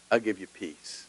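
hushed promise. Retold or imitated. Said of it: "God will say".

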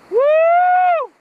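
high, loud whoop of real thrill; sudden joyful yell; excited whoop. Real, not acted. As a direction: let out a whoop as the fish takes line. Fish running line out.

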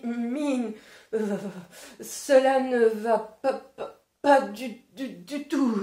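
shivering and stammering with cold, stuttering sounds; teeth chattering. Acted. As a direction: stutter through it like teeth chattering from icy water. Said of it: "en claquant des dents".

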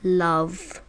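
Love said alone, firm and deliberate; emphatic love. Real, not acted. Insisting to viewers the coop has love, firm. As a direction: land the single word Love. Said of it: "Yeah, you heard me".

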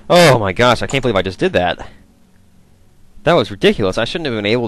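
loud astonished oh my gosh; surprised. Real, not acted. Shocked at own lucky lockpick.

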